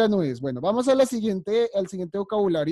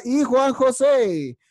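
louder, higher, slow call to a pupil; teacher calling out to Juan José on the call, louder and drawn out; 5 semitones higher, 6 dB louder, much slower than usual. Real, not acted. Teacher calls on a student.

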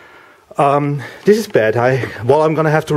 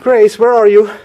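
high, louder call for the cat; 7 semitones higher, 6 dB louder than usual. Real, not acted. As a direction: shout for the cat across the house.

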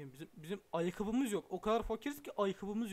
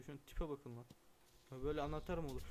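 normal talking voice

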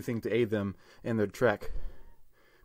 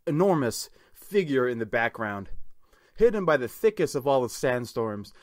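ordinary delivery

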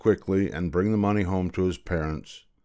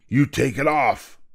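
higher, firm royal order; stern command; 5 semitones higher than usual. Acted. King orders the boy to remove the hat, stern. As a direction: order him with no room for argument.

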